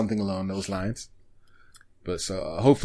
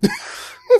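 helpless, high-pitched cackle; cry-laughing, high and thin; high, teary squeal of laughter; 3 dB louder than usual. Real, not acted. Laughing at Decathlon anime.

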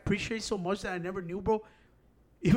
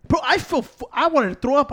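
worked-up disgust; 6 semitones higher, 6 dB louder than usual. Retold or imitated. Recounting disgust at the yogurt.

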